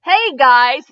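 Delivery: loud, high YouTuber hello; hammed-up channel intro; bright intro greeting. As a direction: greet the viewers with a big hello. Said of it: "vlog intro greeting".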